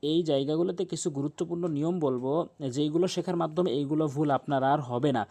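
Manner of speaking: normal talking voice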